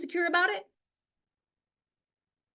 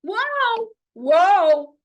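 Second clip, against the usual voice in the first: whoa rising sharply higher, last one much louder; mock alarmed whoa; 9 semitones higher, 6 dB louder, much slower than usual. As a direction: play a critic suddenly alarmed, hammed-up. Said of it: "it was like".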